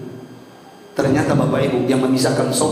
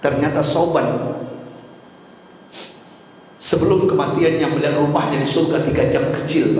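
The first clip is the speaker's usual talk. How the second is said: usual speaking voice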